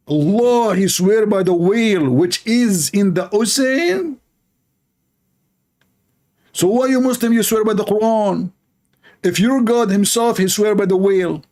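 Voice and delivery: high mocking disbelief; mocking incredulity. Ridiculing the whale oath.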